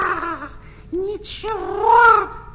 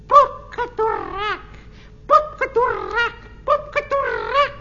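loud squawky parrot cry, climbing higher; parrot squawk; 6 semitones higher, 4 dB louder, faster than usual. Acted. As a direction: screech it like a proud parrot. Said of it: "я знаю даже несколько человеческих слов".

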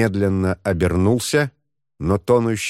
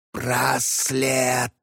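wailing, high ghostly moan; sobbing ghost voice; 4 semitones higher, 3 dB quieter than usual. Acted. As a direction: wail like a mournful ghost. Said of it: "С каждым стоном призрак".

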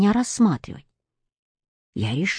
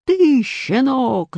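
slow, high furious roar; roaring boyar; 6 semitones higher, much slower than usual. A narrator's reading. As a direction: bellow the insult at the boy. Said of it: "заревел боярин". (ревёт медленно и гневно)

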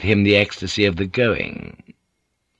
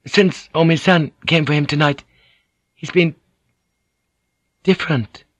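high woman's voice, halting towards the end; hesitant interpreter, pitched well up; Mariko, uncertain and apologetic; 7 semitones higher, 3 dB louder, faster than usual. Acted. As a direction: search for the words, unsure.

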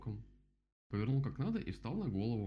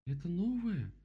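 high-pitched storybook bear; voiced bear character; 10 semitones higher, 4 dB louder, slower than usual. A narrator's reading. Bear looks over the dam, high character voice.

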